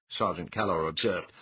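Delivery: ordinary delivery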